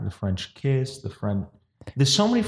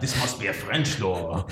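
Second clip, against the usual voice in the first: softer mock French accent; 4 semitones lower, 6 dB quieter than usual. Imagined Frenchman judging the door, French accent. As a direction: finish in a put-on French accent. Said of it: "I love your French accent".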